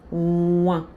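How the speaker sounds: "Ouin" as a strongly nasal wɛ̃ sound; nasal "Ouin" shown on its own; nasal vowel. Shown as a demonstration. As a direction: hum the vowel "Ouin" through the nose. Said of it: "O som vai ser nasal".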